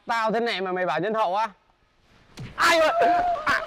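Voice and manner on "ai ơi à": mock calling out.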